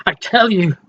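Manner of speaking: plain reading voice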